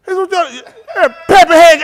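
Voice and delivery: put-on high, slow, loud old-timer; hammed-up old man. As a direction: squeal pepperhead like a cranky old man. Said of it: "he said".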